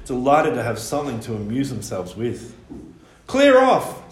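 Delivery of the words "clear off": high, loud cry to clear off; old man shouting at the youths to clear off; angry shout. A narrator's reading. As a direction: bark it out at the boys. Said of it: "said Mister McNumpty".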